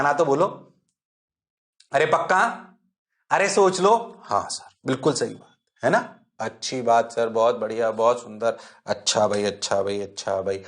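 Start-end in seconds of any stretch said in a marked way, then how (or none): 4.2-10.6 voiced student reply. Teacher voices students answering.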